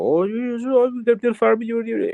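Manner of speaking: high-pitched, mumbled Kerry farmer; mumbly Kerry accent. Acted. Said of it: "Kerry stereotypical accent is more mumbly".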